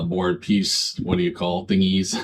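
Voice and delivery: normal talking voice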